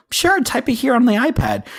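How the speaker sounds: very high, over-polite retold reply; high-pitched nervous customer-service voice; awkwardly obliging. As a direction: squeak out a mock-cheery yes.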